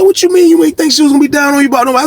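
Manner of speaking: high and fast, can't believe it; repeating his excuse back, stunned; incredulous retort. Retold or imitated. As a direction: throw his words back at him in disbelief.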